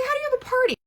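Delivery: high, baffled question. Retold or imitated. Retelling her confusion about MLM parties, pitched high. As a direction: squeak it out, totally lost. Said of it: "I'm like".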